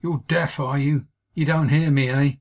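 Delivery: mock her cruelly for not answering; taunting sneer. A narrator's reading. Drunk husband taunting wife.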